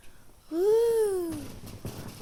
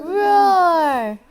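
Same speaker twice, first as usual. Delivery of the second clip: playful roar; 5 semitones higher, 7 dB louder than usual. Shown as a demonstration. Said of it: "let me see you".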